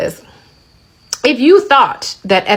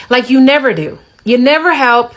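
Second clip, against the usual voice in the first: usual speaking voice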